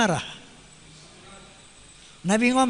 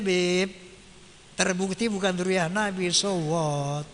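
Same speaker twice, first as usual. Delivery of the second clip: ordinary delivery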